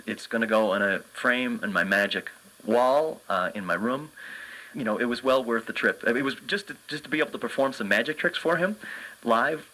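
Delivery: ordinary delivery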